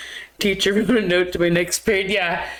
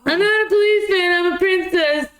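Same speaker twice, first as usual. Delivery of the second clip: much higher, fast, playful Arnold line; hammed-up quote of the movie line; 10 semitones higher than usual. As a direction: toss off the famous line in a high mock voice. Quoting Arnold's kindergarten movie line.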